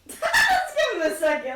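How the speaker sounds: loud laughing repeat of the line. Real, not acted. Player repeats to the table, laughing loudly. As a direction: laugh through the words.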